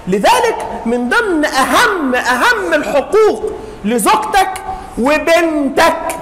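ordinary talk, nothing marked

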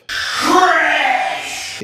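shrill disgusted yell of trash; high shout. Real, not acted. Disgusted outburst at picked-up trash.

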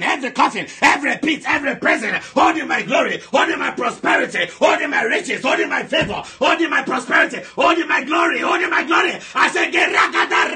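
high, driving deliverance prayer in bursts; full-force prayer shout. Real, not acted. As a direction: hammer out each demand at a shout.

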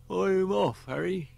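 high, put-on voice of an old drunk; high tipsy old man; Herbert's voice. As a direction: voice him high and a little tipsy.

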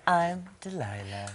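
slow, soft radio host impression; hushed, stretched-out DJ sign-on; Delilah. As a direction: soothe the listeners with your own name. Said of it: "'Cause she's like".

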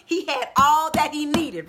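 ordinary talk

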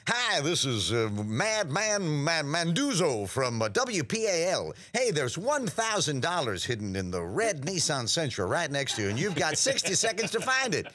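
a cheesy radio host on the prank line; Brockmire voice. Retold or imitated. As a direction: prank call as a slick radio DJ. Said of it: "I would use the Brockmire voice".

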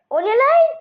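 high startled yelp of alarm; startled shout. Real, not acted. Gamer startled by a sound, loud surprised yelp. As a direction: jump at the noise and blurt it out.

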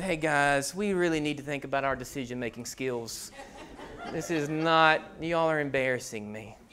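put-on embarrassed child. Son scolding his embarrassed family, soft and low. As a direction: play the mortified kid. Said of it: "Lennon looked at us and said".

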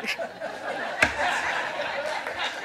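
soft unvoiced laugh of disbelief; breathless laugh. Real, not acted. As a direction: laugh quietly at how unlikely it is. Laughing at his own story.

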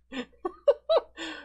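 shrill giggle way up high; high character laugh. Acted. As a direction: let out a squeaky delighted laugh. Guildmaster's put-on laugh.

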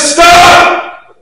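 sudden high shouted stop; mom yelling stop. Acted. Mother screaming at her child running into the street. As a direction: shout it like a mother seeing danger. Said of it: "She yells out".

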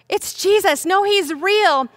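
acted thrilled disciple; excited announcement. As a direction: burst out with the news, pitched high.

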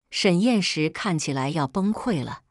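ordinary delivery, nothing marked

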